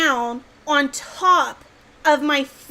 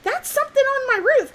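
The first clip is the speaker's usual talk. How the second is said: high-pitched, doubting double take; retold startled disbelief; 10 semitones higher, faster than usual. Storyteller replaying seeing something on the roof, startled disbelief. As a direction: replay the moment of doubting your own eyes, pitched up. Acts out spotting creature on roof.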